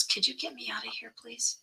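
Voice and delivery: a faint, breathless whisper begging for help; whispered plea. Retold or imitated. Dying aunt whispering a plea to be taken out. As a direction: whisper it like someone too weak to speak.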